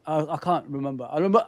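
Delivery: usual speaking voice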